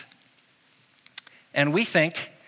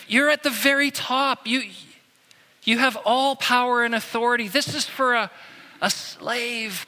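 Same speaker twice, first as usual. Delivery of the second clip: disciples protesting; 7 semitones higher than usual. Retold or imitated. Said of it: "the disciples just saying".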